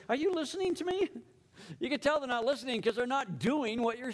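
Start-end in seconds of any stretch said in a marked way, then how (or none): 0.1-1.1 much higher-pitched parental demand; exasperated parent. Acted. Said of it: "Like the parent who says".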